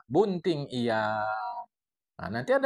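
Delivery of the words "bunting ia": Bunting drawn out very slowly, clear u; the final right reading; correct reading. Shown as a demonstration. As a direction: lay it down slowly and firmly. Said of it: "Nah, ini ada ia".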